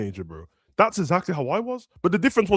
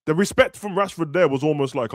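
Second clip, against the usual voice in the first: ordinary talk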